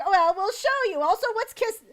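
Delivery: high, loud, keen humans; hammed-up eager show-and-tell; eager explaining. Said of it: "and they're like".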